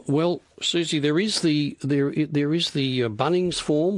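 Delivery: ordinary talk, nothing marked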